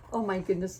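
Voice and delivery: usual speaking voice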